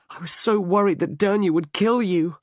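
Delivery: a fiancée gushing with relief, tumbling the words out fast; Angela, reunited with Tom, pours out her relief in a high, fast voice; relieved young woman. A narrator's reading.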